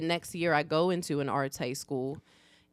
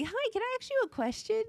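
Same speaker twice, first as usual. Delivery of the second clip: put-on high-pitched little girl asking eagerly; mimicked kid, pitched way up; daughter's child voice; 4 dB quieter than usual. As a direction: imitate a small child's high, questioning voice. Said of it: "our daughter goes".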